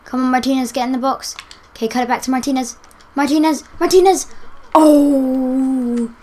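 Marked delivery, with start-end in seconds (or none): 3.2-4.3 loud high shout of the name; calling out. Real, not acted. Gamer shouting his striker's name before the goal. 4.7-6.1 loud elated cry; excited cry. Real, not acted. Gamer crying out as his striker scores again.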